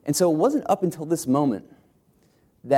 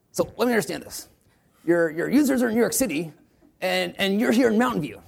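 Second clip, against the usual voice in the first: higher, incredulous mentor voice; Paul Graham impersonation; 8 semitones higher than usual. Retold or imitated. Investor questioning founders why they're far from users, higher mocking voice. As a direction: spell out the obvious like a puzzled investor. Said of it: "I'll try to do my best Paul Graham impersonation".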